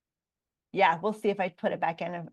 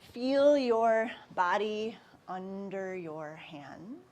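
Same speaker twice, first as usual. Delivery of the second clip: guided meditation; 7 dB quieter than usual. Acted. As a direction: ease the class into stillness.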